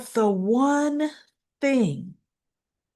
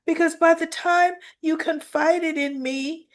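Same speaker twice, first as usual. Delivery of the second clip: lightly voiced, higher pleading; pleading Nina; 8 semitones higher than usual. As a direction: justify yourself, almost begging. Nina pleads her case.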